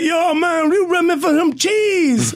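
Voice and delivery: high, drawn-out toothless plea; toothless homeless guy. Retold or imitated. Homeless man asks for cheese, toothless imitation. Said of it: "he's got no teeth".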